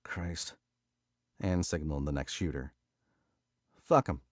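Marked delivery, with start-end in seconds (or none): 0.0-0.5 mumbled curse. A narrator's reading. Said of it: "Tony mumbled". 3.7-4.2 dismissive. A narrator's reading. Mickey dismissing them.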